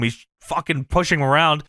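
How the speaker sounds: normal talking voice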